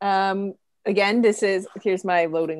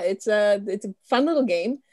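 ordinary delivery

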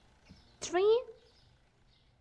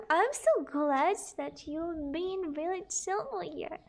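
ordinary talk, nothing marked

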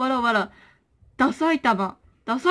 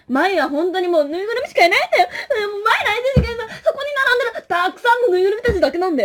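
mock sulky crying; 6 semitones higher, faster than usual. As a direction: whine like a lonely toy. Plush voice again. (高い声で泣きべそをかくふり)

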